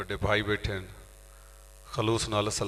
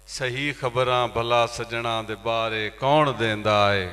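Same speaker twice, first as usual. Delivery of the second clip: ghazal recitation; 4 semitones higher, 5 dB louder, slower than usual. Acted. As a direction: recite couplets to an audience with drawn-out endings. (ਗਜ਼ਲ ਦੀ ਮੁਸ਼ਾਇਰੇ ਵਾਲੀ ਅਦਾਇਗੀ)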